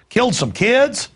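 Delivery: outraged disbelief. Real, not acted. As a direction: throw the boast back at them, appalled. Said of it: "incredulous echo of the boast".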